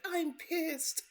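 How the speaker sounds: mock-angry granddaughter; pissed child. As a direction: declare it like an angry kid. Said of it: "she's like".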